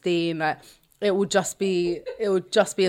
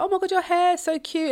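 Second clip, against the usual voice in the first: put-on high-pitched cooing over a child; pitched-up mock adoration; imitated gushing admirers; 12 semitones higher than usual. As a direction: mimic fans fawning over a cute kid. Voicing people fussing over her son.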